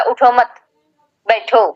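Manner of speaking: "उठो मत" as one phrase, break, then "बैठो"; pause after मत. Shown as a demonstration. Teacher wrongly telling a student to stand, pausing after "मत". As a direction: stop for breath after "मत", then say "बैठो" separately. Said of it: "पे उसने सांस तोड़ दी".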